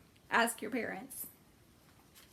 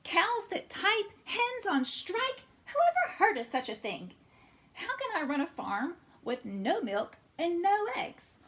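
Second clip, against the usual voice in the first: furious farmer; 8 semitones higher, 4 dB louder than usual. A narrator's reading. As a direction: rant in disbelief at the animals. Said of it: "Farmer Brown was furious".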